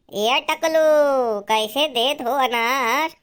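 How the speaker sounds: high, loud, drawn-out call to a seller. Acted. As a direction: sing out the name, stretching it long. Customer calls out to fruit seller.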